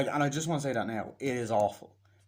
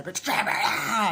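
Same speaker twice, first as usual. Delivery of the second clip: higher and louder shout ending in a cry; mock attacker yell; 9 semitones higher, 6 dB louder, slower than usual. Attacker shouts during the beating, mock yell. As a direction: bark it like a thug in the brawl. Attackers taunt in retold scene.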